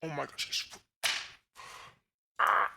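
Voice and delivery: ordinary talk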